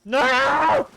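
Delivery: high loud cry of dismay; alarmed shout. Real, not acted. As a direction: yell in panic. Attacked by dinosaur.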